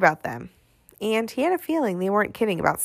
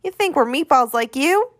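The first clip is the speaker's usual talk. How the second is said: put-on higher-pitched, indignant little boy; scornful kid's question, pitched up; indignant kid retort; 7 semitones higher than usual. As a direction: challenge him as an insulted kid.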